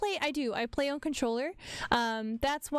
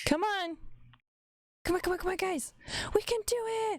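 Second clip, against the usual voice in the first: high, pushing encouragement; rally the team, rising higher; urgent cheering; 6 semitones higher, faster than usual. Real, not acted. Cheering team through check.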